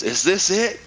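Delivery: high, anxious pilot voice; pilot asking. Retold or imitated. Pilot turning round to ask where they landed, confused. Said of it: "looks at me and says".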